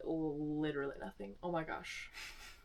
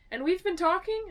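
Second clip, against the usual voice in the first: doubtful question; 11 semitones higher, 6 dB louder than usual. Retold or imitated. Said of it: "And she was like".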